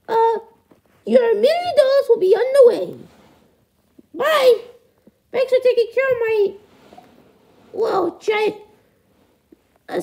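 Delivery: high falsetto puppet parent; very high-pitched parent voice; duckling's parent, leaving. Acted. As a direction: say goodbye and haul your son in a squeaky voice.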